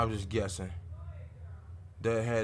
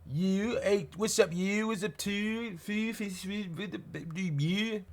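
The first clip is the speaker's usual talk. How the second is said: high, hammed-up Baltimore accent; a high singsong send-up of the Baltimore accent; mock Baltimore accent; 8 semitones higher, slower than usual. Mocking the Baltimore accent to his viewers. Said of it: "you forty minutes up the road and you talk like that".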